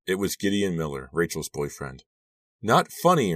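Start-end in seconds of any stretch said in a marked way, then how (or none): 2.6-3.3 higher, louder protest; indignant cry. A narrator's reading. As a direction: cry out at a prank, pitch jumping up. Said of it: "Rachel cried".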